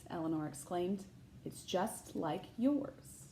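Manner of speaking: put-on bright, lively read-aloud voice for children; picture book narration; storybook reading. A narrator's reading. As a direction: read it like story time for a class.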